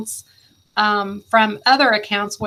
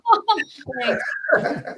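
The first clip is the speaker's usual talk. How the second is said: short amused laugh at being teased; 4 semitones higher than usual. Real, not acted. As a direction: laugh it off lightly. Teasing about waking her up.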